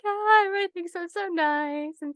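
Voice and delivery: very high, drawn-out goody-goody voice; mock sweet innocence. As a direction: coo it with exaggerated innocence. Recalls reacting as winning secret Mafia.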